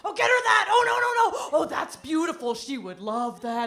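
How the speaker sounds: high, loud gushing that eases off by the end; excited husband. Retold or imitated. Husband on the phone to his shopping mother, getting excited. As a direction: burst out high, then settle into approval. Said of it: "he's getting excited".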